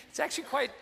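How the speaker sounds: ordinary talk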